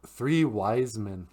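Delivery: ordinary talk, nothing marked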